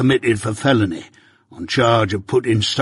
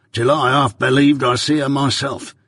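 ordinary delivery

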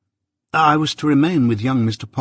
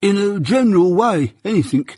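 higher, snuffly, stuffed-up voice; voice with a cold; 9 semitones higher, 3 dB louder than usual. A narrator's reading. Mike reporting to Jaggers, snuffly with a cold. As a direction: talk through a blocked nose. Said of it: "in the voice of a sufferer from a constitutional cold".

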